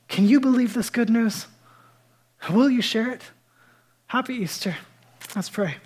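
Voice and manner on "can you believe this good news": ordinary delivery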